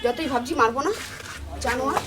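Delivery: bitter muttering she can barely hold in; seething under breath. Real, not acted. Wife fuming after the quarrel.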